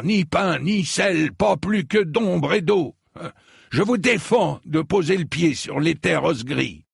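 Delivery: put-on high, loud, angry old lord; thundering prohibition. A narrator's reading. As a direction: forbid entry with full indignation. Old knight forbids crossing, still thundering. (interdiction tonnante et indignée)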